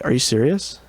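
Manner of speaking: ordinary delivery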